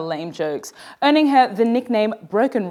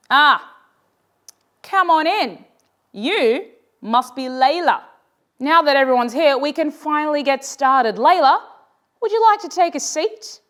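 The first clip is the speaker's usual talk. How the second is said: teacher welcoming; 5 semitones higher than usual. A narrator's reading. Teacher greets Layla in class.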